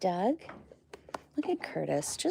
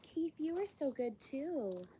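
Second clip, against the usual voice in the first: higher, softer, slow praise; soft pet praise; 9 semitones higher, 8 dB quieter, slower than usual. Real, not acted. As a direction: praise the animal tenderly. Praising animal softly.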